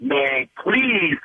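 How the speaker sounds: normal talking voice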